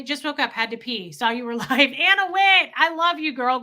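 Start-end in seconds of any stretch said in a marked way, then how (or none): 1.7-3.6 higher and louder, delighted to see her; light up greeting a friend; excited greeting. Real, not acted. Greeting a viewer in chat.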